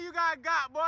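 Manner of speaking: ordinary talk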